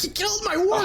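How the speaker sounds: put-on high, shrill-free squeaky girl voice; angry girl Elsa. Girl scolding Otis over her dead wargs, high pitched. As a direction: play a furious twelve-year-old girl. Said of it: "She's like".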